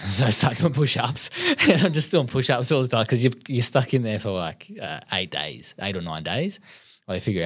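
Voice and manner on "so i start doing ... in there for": laughing through it. Real, not acted. Laughing while recalling jail training.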